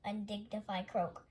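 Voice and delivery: usual speaking voice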